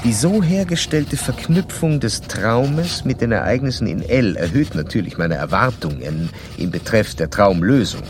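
measured narrator reading a clinical report; formal case narration. Acted. Doctor narrating his case study to the listener in a formal reading voice. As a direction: recount the case like reading from your own notes.

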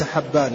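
حبان with a doubled b in place of y; wrong reading. Shown as a demonstration. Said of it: "ليس بالموحدة".

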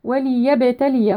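slow وليبتلي, a full i after the l: wa-li-yabtaliya; clear kasra on the lam. Shown as a demonstration. Teacher modelling the verse word, distinct li in وليبتلي. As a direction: recite وليبتلي giving li its own syllable. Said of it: "نبين كسرة اللام ونحقق الكسرة".

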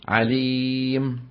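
عليم recited very slowly, ending on a silent m; slow pause form. Shown as a demonstration. Teacher demonstrating the pause on the word, very slowly. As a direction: stop on عليم with no vowel, drawn out. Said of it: "ويقفون بالسكون فيقولون".

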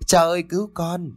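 put-on high child's voice, crying and pleading; a young boy begging for mercy through tears; sobbing child begging. A narrator's reading. A boy kneeling begs the cruel neighbor, crying. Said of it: "khóc lóc thảm thiết".

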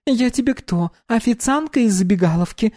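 put-on bright high young woman; high girl voice. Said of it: "презрительно фыркнула".

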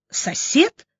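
high, rising, doubting echo; sceptical snort. A narrator's reading. Doctor doubting Vanya's claim, sceptical huff. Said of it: "хмыкнула Настя".

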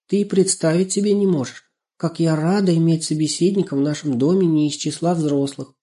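put-on high, eager girl's voice; glad little girl. A narrator's reading. Girl asking her friend for news, glad and eager. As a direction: chat happily with a missed friend.

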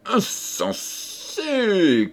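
a hint of the prince's booming challenge; thundering voice. A narrator's reading. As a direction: draw out the cry in scorn. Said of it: "cria le prince Azor d'une voix tonnante".

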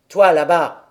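loud shout across the pasture; shouted call. A narrator's reading. Old man shouts to the goat boy Pierre, loud. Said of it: "cria-t-il à Pierre".

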